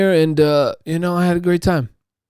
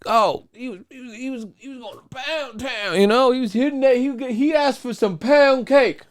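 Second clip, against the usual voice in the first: high giggling tease, voice pitched up; giggly teasing; 10 semitones higher than usual. Real, not acted. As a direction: snicker through the innuendo. Teasing about a friend's sex.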